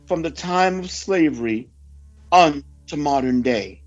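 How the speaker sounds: ordinary talk, nothing marked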